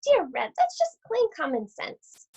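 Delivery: put-on wolf putting on a sugary old lady voice; wolf as sweet granny.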